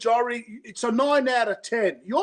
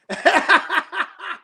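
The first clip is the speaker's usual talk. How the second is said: loud, high burst of laughter; hearty laugh; 7 semitones higher, 5 dB louder than usual. Real, not acted. Guest mocking a stingy host, loud laughing. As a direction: laugh out loud at your own joke.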